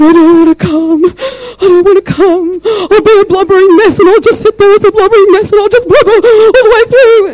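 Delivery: loud, very high hammed-up wailing; exaggerated high-pitched sobby whine; mock blubbering. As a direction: imitate someone blubbering in protest. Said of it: "And she was blubbering".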